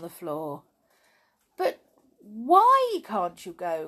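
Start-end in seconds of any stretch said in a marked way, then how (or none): 1.6-3.9 loud, slow question with a drawn-out WHY; puzzled cry. A narrator's reading. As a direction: ask it in baffled dismay. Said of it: "cried Jolly, feeling more and more puzzled".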